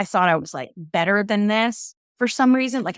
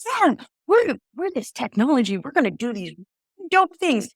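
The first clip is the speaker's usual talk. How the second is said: mock hype; 5 semitones higher than usual. Mimics a startup hyping itself.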